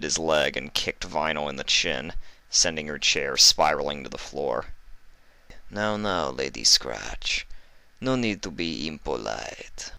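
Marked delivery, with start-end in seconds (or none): 5.7-9.9 mocking villain. Livestrong mocking Vinyl after she is kicked, oily and soft. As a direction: scold her with fake politeness.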